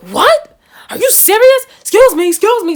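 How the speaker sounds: loud, high-pitched disbelief; retold confrontation, loud and indignant; outraged. Retells friend's outraged confrontation.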